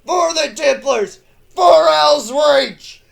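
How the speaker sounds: roaring, slow chant of a war cry; fervent war chant, loud and high; battle cry. Acted. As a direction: lead the charge with a roar. Reclusiarch leads the battle cry.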